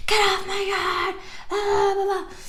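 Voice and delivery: a high, mocking whiny neighbor voice; high mocking imitation of the neighbor yelling at kids; mock angry neighbor. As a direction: parody the nagging neighbor up high. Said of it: "started bitching. She was like".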